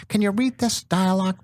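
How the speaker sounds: high-pitched mimicked producer; Bob Wellborn. Producer handing the actor a page of script, high imitated voice. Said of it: "He talks like this".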